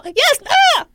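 high, loud yapping impression; angry dog. Woman interrupting in the background, yapping like an angry dog. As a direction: yap like a worked-up dog, hammed up. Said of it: "is like an angry dog".

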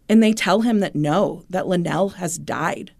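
usual speaking voice

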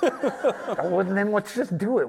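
high-pitched retold quote, sliding down; mock breezy resolve. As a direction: pitch it up, as if shrugging off the worry. Retelling an eager change of mind.

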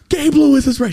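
high, loud excited yell to his wife. Retold or imitated. Telling his wife he spotted the actor, excited yell. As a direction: blurt it out, thrilled and loud. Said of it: "I go, Rosie".

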